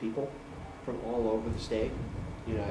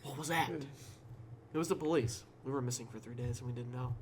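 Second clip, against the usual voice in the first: spooky story narration; 9 dB louder than usual. Acted.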